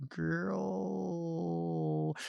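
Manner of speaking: soft, suspicious cat growl, held long; catlike growl. Shown as a demonstration. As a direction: draw "Girl" out like a growling cat. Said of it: "a cat's sort of growly something".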